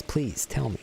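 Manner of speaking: usual speaking voice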